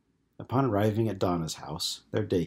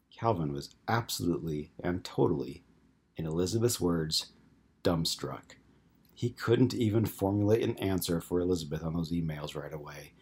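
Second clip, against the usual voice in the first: plain reading voice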